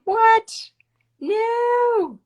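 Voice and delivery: high, loud delighted squeal; thrilled shock at her friend finally joining; surprised squeal. Real, not acted. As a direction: shriek with joyful disbelief. Delighted shock as guest joins.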